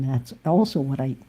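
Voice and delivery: ordinary talk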